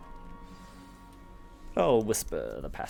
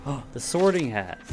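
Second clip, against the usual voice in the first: surprised gasp; 5 semitones higher, 6 dB louder than usual. Real, not acted. Spots the Sorting Hat.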